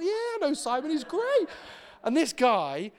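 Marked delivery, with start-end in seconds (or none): 0.0-1.5 much higher and louder, bursting with enthusiasm; eager. Retold or imitated. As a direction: gush about a friend you love. Eager reply in retold chat.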